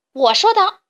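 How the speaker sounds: high, forceful shout back; loud, defiant comeback; loud retort. A narrator's reading. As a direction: assert authority at full volume. Duck shouts back at frogs.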